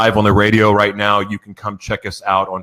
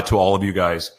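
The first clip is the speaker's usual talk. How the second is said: usual speaking voice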